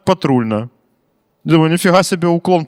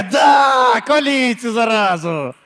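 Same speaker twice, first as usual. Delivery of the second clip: granny yelling; 5 semitones higher, much slower than usual. Retold or imitated. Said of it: "І бабка така".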